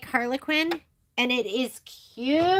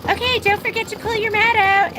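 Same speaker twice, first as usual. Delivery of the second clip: self-reminder; 9 semitones higher, 5 dB louder than usual. Retold or imitated. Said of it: "I'd be like".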